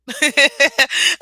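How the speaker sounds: loud, high burst of laughter; real laugh at oneself. Real, not acted. Laughs at her own confession.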